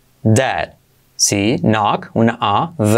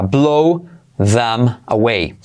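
very slow blow them away; slow explained; much slower than usual. Shown as a demonstration. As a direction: stretch every word out. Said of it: "primero explicado lento". (despacio, palabra por palabra)

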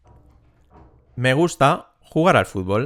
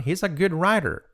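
"he's a good writer" higher, in one linked melody; with intonation; 6 semitones higher than usual. Shown as a demonstration. Teacher modelling intonation, melodic "he's a good writer". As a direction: lift the pitch through "he's a good writer". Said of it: "Dicho con entonación".

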